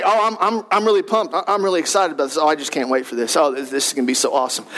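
mock-excited but clearly not; unconvincing excitement. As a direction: say you can't wait without meaning a word. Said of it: "You convinced me otherwise".

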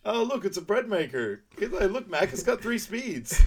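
mock excited pitch. Uncle Frank presenting a bread maker to the kid, mock gushing. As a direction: oversell it like a cheesy salesman.

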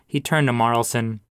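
ordinary delivery

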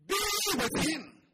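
ordinary talk

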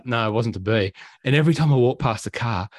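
usual speaking voice

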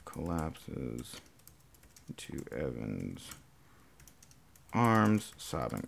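very low, soft, halting self-dictation; hushed and low, piece by piece; murmured dictation. Real, not acted. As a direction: mumble it to yourself while typing. Typing out the story line.

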